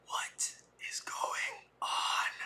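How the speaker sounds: slow frightened whisper; scared inner whisper. Retold or imitated. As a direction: whisper your thoughts alone in the dark van. Frightened thought in dark van.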